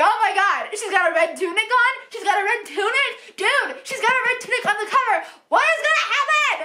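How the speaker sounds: high, fast fangirl freak-out; frantic excitement. Retold or imitated. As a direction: replay a reader's rising panic, pitch climbing to the end. Retelling her frantic reader reaction.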